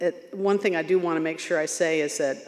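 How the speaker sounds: usual speaking voice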